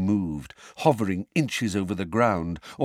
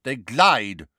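louder, higher sharp correction; 5 semitones higher, 5 dB louder than usual. A narrator's reading. Judge corrects the witness's wording, sharp. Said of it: "said Madam Bones sharply".

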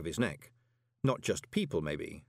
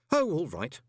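higher, trying to sound jovial; forced joviality; 8 semitones higher, 4 dB louder than usual. A narrator's reading. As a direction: put on cheer you don't quite feel. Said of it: "trying to sound jovial".

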